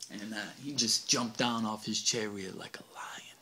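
hushed, whispered suspense. A narrator's reading. Narrator building suspense, whispered. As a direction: drop to a whisper for the pounce.

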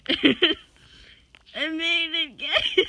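slow, laughing through the words. Real, not acted. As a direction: giggle it out, giddy. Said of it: "I sound like a dying hyena".

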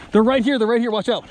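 high, loud alarm; urgent warning. Real, not acted. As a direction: warn the squad fast. Warning teammates under fire.

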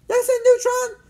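high, loud demand; demanding girl. A narrator's reading. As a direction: order him to listen at top pitch. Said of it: "she demanded".

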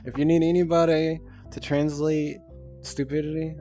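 ordinary talk

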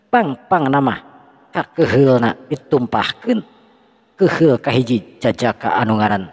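usual speaking voice

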